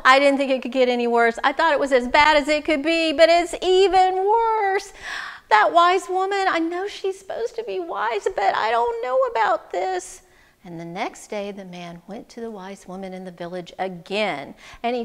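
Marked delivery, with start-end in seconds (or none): 0.1-10.2 rattled grumbling, climbing in pitch; exasperated complaint. Acted. Man doubting the wise woman's advice, exasperated. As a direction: rush the complaint, then rise into doubt.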